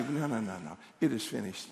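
low, soft declaration; understated, settled refusal; quiet self-talk. Shown as a demonstration. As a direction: say it quietly to yourself. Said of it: "just say".

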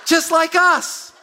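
high, slow and laughing, mimicking the lady; laughing punchline. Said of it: "She goes".

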